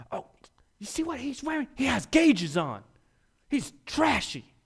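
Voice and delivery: high, mocking gossip voice; gossiping churchgoer. Church gossips sneering at a man with gauges, high mocking voice.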